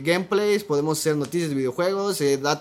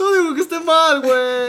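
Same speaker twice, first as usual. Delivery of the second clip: raised high insistent voice; loud protest; 12 semitones higher, 7 dB louder than usual. Real, not acted. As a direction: push back louder and higher. Protesting loudly in argument.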